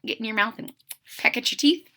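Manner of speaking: ordinary delivery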